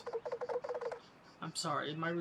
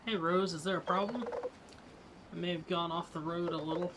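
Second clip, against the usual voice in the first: ordinary talk, nothing marked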